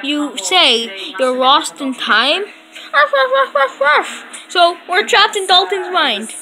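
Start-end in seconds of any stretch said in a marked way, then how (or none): 2.9-4.2 high playful woof woofs; dog barking. Pretend. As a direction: bark like a pet dog.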